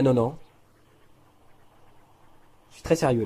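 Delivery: normal talking voice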